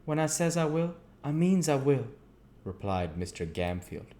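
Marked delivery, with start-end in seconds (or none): 0.0-2.1 high, loud stubborn sweep; chimney sweep vowing to the magistrate; doggedly. A narrator's reading. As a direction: insist on it doggedly.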